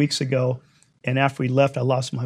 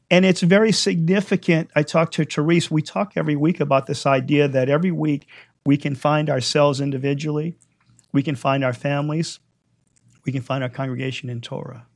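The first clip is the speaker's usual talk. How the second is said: ordinary talk, nothing marked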